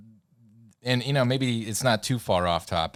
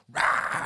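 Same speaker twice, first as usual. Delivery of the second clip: roaring mock zombie scream; sudden "rah" roar to startle a crowd; jump-scare monster yell, acted out; imitated scream. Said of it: "just start screaming".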